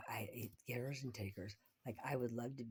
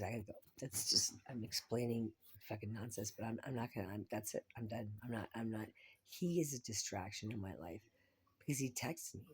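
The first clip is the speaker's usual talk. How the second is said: normal talking voice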